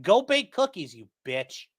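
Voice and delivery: ordinary talk, nothing marked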